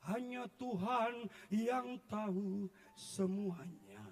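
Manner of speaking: very slow, solemn, put-on holy voice; mock pious answer. Husband dodging the pastor's question with mock piety. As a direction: stretch it out like a saintly dodge.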